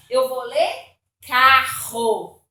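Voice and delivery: "carro" with a strong throaty R, slow and loud; "carro" with a raspy guttural RR, drawn out; "carro" with a hard throat R, slowly. Shown as a demonstration. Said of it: "dois R's dão força".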